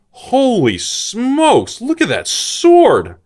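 high, slow, drawn-out gasp of awe; amazed. Real, not acted. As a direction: stretch it out in wonder at the sword. Amazed by a pulled card.

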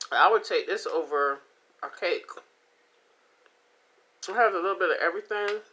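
normal talking voice

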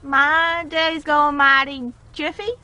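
slow put-on country drawl; country accent. Friend answers about her day in a slow country accent. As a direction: stretch it out in a pretend country accent. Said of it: "how to do my country accent".

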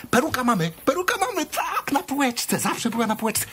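high-pitched delighted exclamation; excited realisation. Acted. Remembering mother's wig on the shelf, excited. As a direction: jump up in pitch as the idea strikes.

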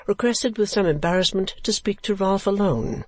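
ordinary delivery

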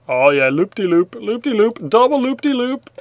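rising singsong loop-de-loop; playful singsong. Pretend. Narrating fish doing loops.

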